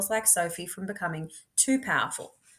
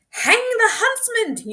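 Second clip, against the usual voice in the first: high, loud, slow outraged cry; furious demand for the huntsman; shout; 9 dB louder, slower than usual. Acted. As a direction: yell it like an angry mob. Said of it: "you shout".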